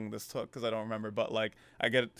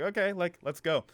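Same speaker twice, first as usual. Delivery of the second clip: excited; 7 semitones higher than usual. Retold or imitated. Kid excited about no school.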